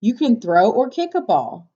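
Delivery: ordinary talk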